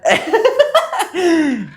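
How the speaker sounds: real amused laughter; high laugh. Real, not acted. Nervous laugh retelling call.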